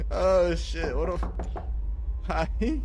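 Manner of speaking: ordinary talk, nothing marked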